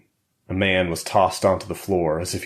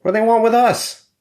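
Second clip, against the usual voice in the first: very high, loud, distressed question; panicked; 6 dB louder than usual. A narrator's reading. Captive man asks about kidnappers, panicked. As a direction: wail the question in alarm.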